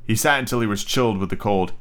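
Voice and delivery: ordinary delivery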